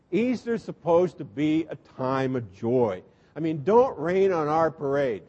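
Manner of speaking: ordinary delivery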